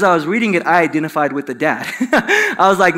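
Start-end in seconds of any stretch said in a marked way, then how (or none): 1.9-2.5 a sudden, high, amused laugh. Real, not acted. Pastor laughing at himself before the congregation, high laugh. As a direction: laugh at yourself, caught out.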